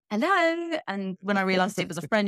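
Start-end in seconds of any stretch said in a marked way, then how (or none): 0.1-0.8 high, prim telephone greeting; mock posh phone hello. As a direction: put on the polished work phone voice. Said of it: "my phone voice".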